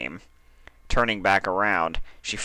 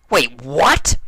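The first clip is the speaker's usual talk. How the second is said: louder, higher startled exclamation; a quick jolt of alarm, pitched up; startled cry; 7 semitones higher, 8 dB louder than usual. A narrator's reading. Dash hearing she'd be tied to the board, startled cry. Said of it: "Dash cried".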